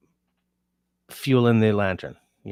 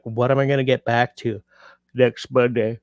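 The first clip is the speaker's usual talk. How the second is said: talking through a yawn; stretched, yawning words; 2 semitones higher, 3 dB louder than usual. Real, not acted. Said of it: "I'm already yawning".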